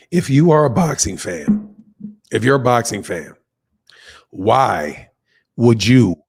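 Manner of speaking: loud, emphatic rant to boxing fans; turned up. Real, not acted. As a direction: crank the volume up and press the point. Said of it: "Let me turn this up".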